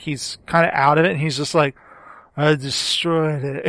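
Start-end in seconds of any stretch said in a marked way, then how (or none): 2.4-3.7 put-on drunk-sounding. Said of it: "He almost sounds drunk".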